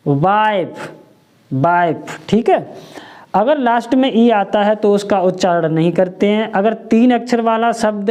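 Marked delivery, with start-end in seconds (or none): 0.1-2.2 वाइफ said twice as wife with a falling close; वाइफ pronounced clearly for the class; model word. Shown as a demonstration. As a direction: give वाइफ like the English wife. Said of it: "इसको हम क्या पढ़ेंगे".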